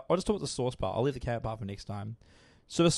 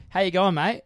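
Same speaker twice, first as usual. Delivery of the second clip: broad Aussie greeting, higher and louder; Australian accent; 5 semitones higher, 8 dB louder than usual. Retold or imitated. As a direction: imitate a friendly Australian nan. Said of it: "she sounds more Australian than me".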